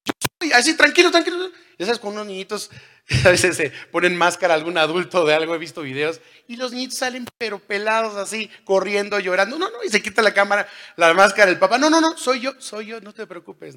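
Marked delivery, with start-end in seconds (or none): 0.0-1.5 high, loud calling out over the storm; almost shouting. Retold or imitated. Jesus calling to disciples on the lake, almost shouting. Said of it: "casi gritándoles". 11.8-13.8 high, quick hurried father soothing; calm the crying kid fast; reassuring dad. Retold or imitated. Father pulling off mask to calm his crying child.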